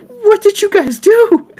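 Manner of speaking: hammed-up furious old woman; high mock outrage, drawn out; outraged old lady. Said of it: "she's like".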